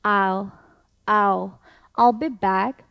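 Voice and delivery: I'll be back with I'll as one syllable, gliding straight into L; drilled I'll. Shown as a demonstration. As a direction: repeat as a pronunciation drill. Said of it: "다시 한 번 더". (반복 연습하는 I'll 발음)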